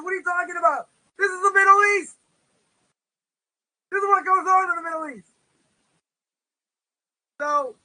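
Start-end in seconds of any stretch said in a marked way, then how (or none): 0.0-5.3 higher, louder punchline retort; raised-pitch, emphatic storyteller's quote; exasperated scorpion. Acted. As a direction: exclaim it as the scorpion's indignant comeback.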